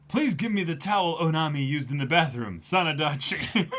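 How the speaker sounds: stifle laughter through the line; laughing while reading. Retold or imitated. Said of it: "reading pervy request laughing".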